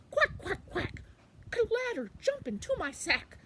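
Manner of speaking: high, loud quacking duck. Acted. Quackling inviting the ladder into the sack, high loud duck voice. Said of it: "so he said".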